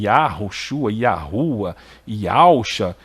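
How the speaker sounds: "Yahushua, Yahua, Yausha" listed low, each starting Ya; mispronounced names. Retold or imitated. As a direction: cite the faulty variants one by one, with A first. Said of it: "essas pessoas que falam esse nome". ("Yahushua, Yahua, Yausha" em tom baixo, com A)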